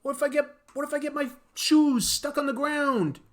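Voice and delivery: high, fretful hero voice; whiny Spider-Man. Acted. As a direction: whine like a worried superhero. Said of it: "Spider-Man going".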